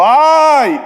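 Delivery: loud, high comic yelp; hammed-up high shout of pain; mock cry of pain. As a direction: yelp like it really hurts, hammed up.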